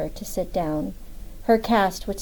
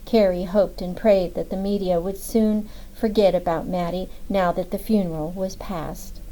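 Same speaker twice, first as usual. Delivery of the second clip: plain reading voice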